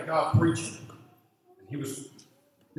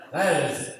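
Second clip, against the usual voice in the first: usual speaking voice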